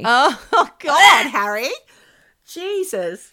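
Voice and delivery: loud, slow laughing cry of disgust; laughing groan. Real, not acted. Host reacting to co-host's crude joke, laughing out loud. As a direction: crack up in appalled disbelief.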